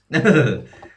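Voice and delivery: short loud chuckle; laugh. Real, not acted. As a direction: laugh briefly at your own silly rhyme. Laughs at own silly rhyme.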